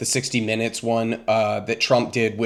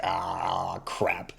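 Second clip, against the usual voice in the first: mock dismay; 7 semitones lower, 10 dB quieter than usual. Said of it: "and he's just like".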